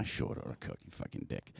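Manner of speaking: unvoiced teasing whisper; whispered jab at a friend; whispered aside. Real, not acted. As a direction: whisper the jab under your breath.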